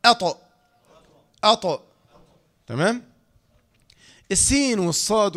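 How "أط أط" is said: "أط" said high and loud, the held air let out; released air. Shown as a demonstration. Teacher shows how to let the air out, "أط" released forcefully. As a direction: release the trapped air after "أط". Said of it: "أردنا أن نخرج هذا الهواء".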